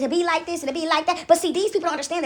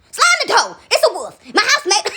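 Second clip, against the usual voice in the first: loud, higher cry of alarm breaking into laughter; mock alarm; 5 semitones higher, 3 dB louder than usual. Pig warning the house about a wolf, in mock alarm. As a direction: sound the alarm while cracking up.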